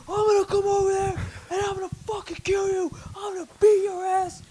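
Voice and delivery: very high, strained hillbilly threats; hammed-up outraged hillbilly in a squeaky high pitch. Online player threatening the guy who bumped him, hammed-up rage. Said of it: "redneck, hillbilly, so serious".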